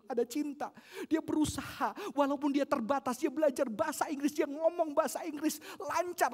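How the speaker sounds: impassioned preacher climbing in pitch; rising sermon fervor. Acted. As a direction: build the story with growing fervor. Preaching builds in intensity.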